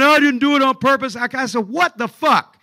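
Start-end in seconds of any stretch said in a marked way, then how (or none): 0.0-1.2 loud, raised-pitch mock protest; indignant denial. Retold quarrel, wrestler protests innocence. 1.7-2.6 loud, higher mock outburst; retold exasperation. Retold reaction to the quarrel.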